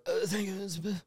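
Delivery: high, soft, flustered mumble; nervous. Retold or imitated. Customer too nervous before his crush, nervous squeak. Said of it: "always too nervous".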